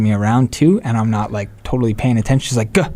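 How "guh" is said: mock-intense grunted reply. Imitating adopted sister who is half listening, intense grunt. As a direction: imitate a somewhat intense one-word grunt. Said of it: "kind of intensely".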